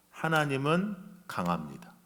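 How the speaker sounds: ordinary talk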